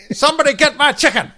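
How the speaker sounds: high, loud hammed-up old colonel cry; Colonel Sanders. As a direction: yell it like a cartoonish fried-chicken colonel. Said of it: "Like Col-, Colonel Sanders".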